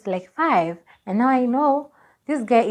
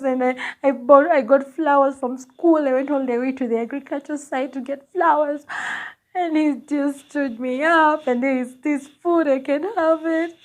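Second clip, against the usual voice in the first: crying retold; 6 semitones higher than usual. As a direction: re-enact sobbing out a complaint to a friend. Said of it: "And then I start crying".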